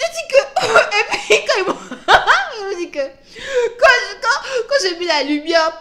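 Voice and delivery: loud, high, laughing hard; cracking up while telling; laughing through it. Real, not acted. Laughing while retelling the scene.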